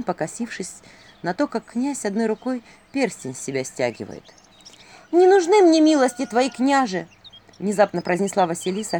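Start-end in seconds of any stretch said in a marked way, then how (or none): 5.1-7.1 high, loud heroine refusal; sudden ringing protest; emphatic refusal. A narrator's reading. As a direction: refuse the prince outright, pitched up. Heroine abruptly refuses prince's favour.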